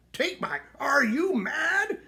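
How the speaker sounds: a knight appalled at the idea; outraged. A narrator's reading. Knight outraged at removing armor.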